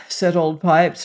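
ordinary delivery, nothing marked

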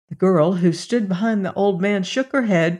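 plain reading voice

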